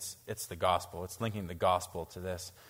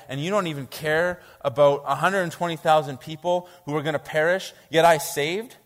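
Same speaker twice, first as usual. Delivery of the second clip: preaching God's reply; 3 semitones higher, 6 dB louder than usual. Acted. God's reply read and paraphrased.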